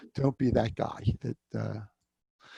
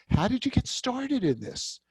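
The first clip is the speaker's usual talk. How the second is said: much higher, eager question; with enthusiasm; 11 semitones higher than usual. Shown as a demonstration. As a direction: ask it with real curiosity and energy. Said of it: "say it with some enthusiasm".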